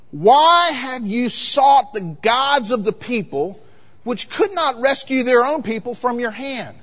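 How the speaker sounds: high, ringing, drawn-out challenge; loud, measured pulpit declamation; prophet's rebuke. Acted. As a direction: confront the king like a preaching prophet.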